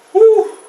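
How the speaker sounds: high, loud exhale of relief; relieved "whew". Acted. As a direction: let out a big "whew". Relief after the threat leaves.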